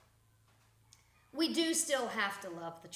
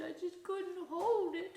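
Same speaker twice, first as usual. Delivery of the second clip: a lightly voiced whiny dog pleading; a hint of a whimpering dog; guilty dog voice; 7 semitones higher than usual. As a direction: plead like a sorry puppy. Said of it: "They're like".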